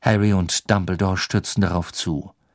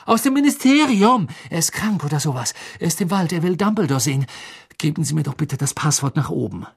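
high-pitched anxious explanation; urgent pleading; 6 semitones higher than usual. Acted. Harry begging Snape for the password, urgent and high. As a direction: press your case, voice raised in pitch.

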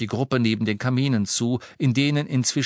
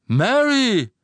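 calling out; 14 semitones higher, 8 dB louder than usual. Acted. Reg calls out to his wife.

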